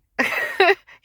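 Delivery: high, loud giggle; giddy laugh. Real, not acted. Laughs at own flirting.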